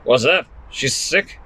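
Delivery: hard-of-hearing grandfather mishearing the joke; deaf grandad. Acted. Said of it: "said Grandad, slightly deaf".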